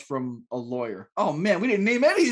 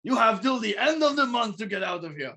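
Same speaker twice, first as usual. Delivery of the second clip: higher-pitched mock Ukrainian landlord; Ukrainian accent; 5 semitones higher than usual. Shown as a demonstration. Showing the landlord's eviction speech, put-on Ukrainian accent. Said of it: "doing it more like Ukraine".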